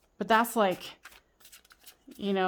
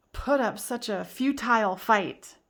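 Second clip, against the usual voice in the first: ordinary delivery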